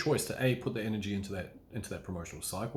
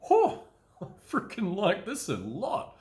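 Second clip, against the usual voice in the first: giddy delight; 11 semitones higher, 4 dB louder, slower than usual. Pretend. Buzzing from own brand energy.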